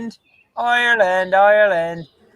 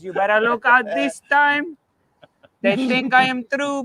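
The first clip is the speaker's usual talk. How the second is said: put-on higher Argentinian accent; 6 semitones higher than usual. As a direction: warn them in an Argentinian accent. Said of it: "the Argentinian accent".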